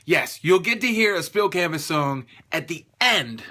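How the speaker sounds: punchy, deliberately excited announcement; emphatic, pumped-up promo delivery; hyped-up excitement. Shown as a demonstration. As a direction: redo it with real hype. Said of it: "I sound so excited".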